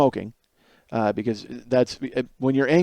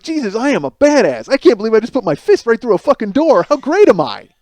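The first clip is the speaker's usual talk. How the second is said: cocky boasting; 8 semitones higher, 7 dB louder than usual. Retold or imitated. Boastful after punching door.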